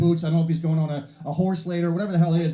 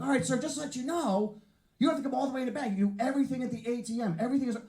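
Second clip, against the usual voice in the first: high put-on clerk voice; rookie teller; 8 semitones higher than usual. New bank teller explaining to a customer, high and officious. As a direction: recite the rules in a high, officious pitch.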